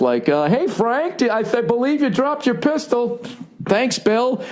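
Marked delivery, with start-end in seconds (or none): 0.5-3.2 higher, polite mock suburban neighbour; chirpy cul-de-sac dad pointing out a gun. Cul-de-sac neighbour politely telling Frank about his pistol. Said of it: "Just classic cul-de-sac". 3.7-4.4 higher, cheery mock suburban thanks; breezy neighbourly thank-you; suburban dad. Frank cheerfully thanking Bill for the gun. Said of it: "Just classic cul-de-sac".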